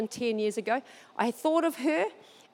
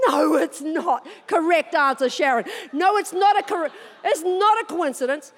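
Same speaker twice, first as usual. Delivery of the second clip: emphatic correction; 4 semitones higher, 5 dB louder than usual. Real, not acted. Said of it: "correcting audience, delighted".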